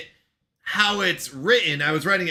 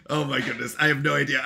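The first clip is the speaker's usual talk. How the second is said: ordinary delivery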